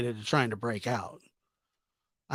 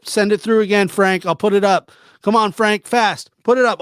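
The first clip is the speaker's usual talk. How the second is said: higher and louder, egging him on; excited urging; 6 semitones higher, 5 dB louder than usual. Real, not acted. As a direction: cheer him to hit it. Urging Frank on.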